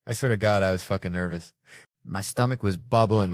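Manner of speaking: ordinary talk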